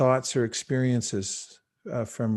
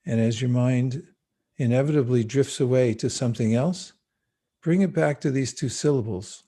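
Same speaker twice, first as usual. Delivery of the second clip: calm spoken meditation with long pauses; guided meditation. Acted. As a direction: ease them gently into the breath.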